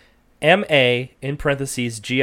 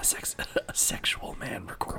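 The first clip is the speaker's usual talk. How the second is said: giggly prank whisper; 7 semitones lower, 9 dB quieter than usual. Pretend. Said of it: "let's whisper into the mic".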